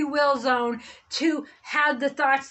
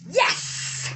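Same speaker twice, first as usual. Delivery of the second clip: excited yes; 8 semitones higher than usual. Real, not acted. Answering are you nuts.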